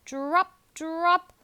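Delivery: ordinary delivery, nothing marked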